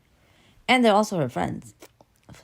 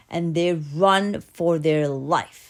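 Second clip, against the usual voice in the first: ordinary delivery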